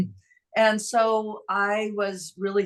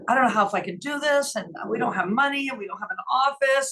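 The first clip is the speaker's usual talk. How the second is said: higher, voice straining near tears; pleading through rising tears; retelling a tearful confession to a friend, voice lifted and strained; tearful plea; 7 semitones higher than usual. Retold or imitated. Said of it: "And I just started crying".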